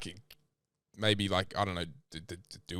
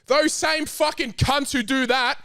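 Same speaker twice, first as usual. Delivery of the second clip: heated rant; 9 semitones higher than usual. Acted. Mocking himself in an argument with his co-host, a high indignant rant. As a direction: rant as if truly wronged.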